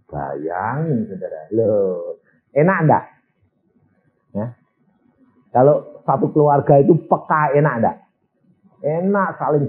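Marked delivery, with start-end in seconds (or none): none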